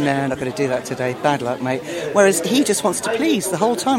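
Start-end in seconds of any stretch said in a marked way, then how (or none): 0.0-1.8 lower, lazy dog refusing; a hint of a can't-be-bothered poodle; lightly voiced offhand dog; stubborn poodle voice. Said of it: "just sort of".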